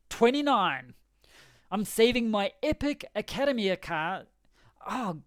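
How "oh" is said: usual speaking voice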